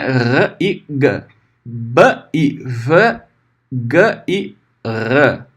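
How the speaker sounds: R e G, B e V, G e R said softly and slowly; teacher contrasting letter sounds; slow letter pairs. Shown as a demonstration. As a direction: spell the pairs slowly for comparison. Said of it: "né?".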